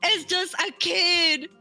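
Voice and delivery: ordinary delivery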